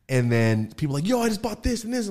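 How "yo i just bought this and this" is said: high, eager mock voice; imitated excited customer. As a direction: chime in like a thrilled buyer. Voicing customers' excited messages.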